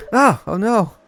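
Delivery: high, loud oh no; rising panic at the mess; alarmed oh no; panicked oh no. Real, not acted. Panics over broken sample.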